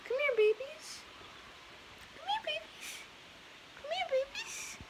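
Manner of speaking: sing-song high coo, calling little ones over. Real, not acted. Girl calling her baby chicks in a very high coo.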